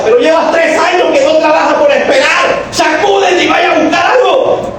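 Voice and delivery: high shouted command; preacher's shout. Acted. Preacher shouting at the congregation to shake it off. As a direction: shout them awake.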